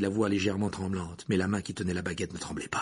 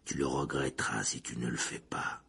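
low, soft, fading into a whisper; much lower and softer, ending voiceless; tense warning; 11 semitones lower, 8 dB quieter than usual. Acted. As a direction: warn him, dropping to a whisper. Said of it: "d'une voix soudain tendue".

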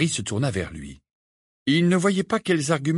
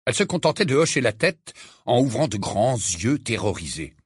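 usual speaking voice

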